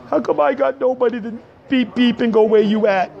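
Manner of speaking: high, mock-wounded lament; plaintive complaint. Acts out lonely complaint at home.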